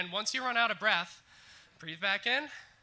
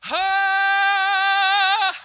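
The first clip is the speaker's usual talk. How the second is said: high, loud sung Ha; sung shout; 16 dB louder than usual. Shown as a demonstration. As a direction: belt out a sudden high note. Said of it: "This is about".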